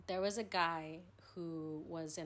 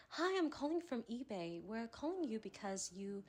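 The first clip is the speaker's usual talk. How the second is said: higher-pitched put-on English accent; 4 semitones higher than usual. Prank caller telling a boy she's from eBay, English accent. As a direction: fake English customer-service caller. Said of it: "do the best English accent".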